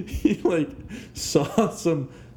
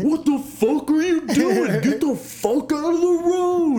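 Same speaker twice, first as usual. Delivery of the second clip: very high, hammed-up road-rage shout; mock furious yell; 14 semitones higher, 4 dB louder than usual. Retelling Herm shouting at a man in the road, mock furious. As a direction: imitate an outraged driver yelling. Retold driver yelling back at pedestrian.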